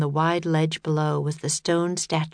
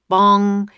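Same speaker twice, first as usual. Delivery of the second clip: mock bell bong; 3 semitones higher, 7 dB louder than usual. A narrator's reading. Clock striking.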